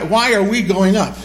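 normal talking voice

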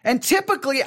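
normal talking voice

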